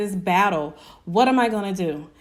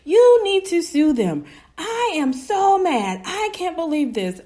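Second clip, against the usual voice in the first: high, loud, indignant outburst; furious aunt; 9 semitones higher, 5 dB louder than usual. Retold or imitated. Aunt raging about the stolen brand, furious and high-pitched. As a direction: imitate an outraged auntie demanding action. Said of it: "very furious. She was like".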